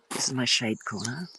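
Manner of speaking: normal talking voice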